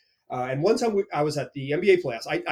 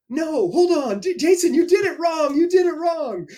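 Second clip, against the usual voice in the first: high, loud mock correction; mock protest; 11 semitones higher, 5 dB louder than usual. Young reporter correcting Jason's greeting, high mock protest. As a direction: scold Jason playfully, pitched up. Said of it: "I'm like".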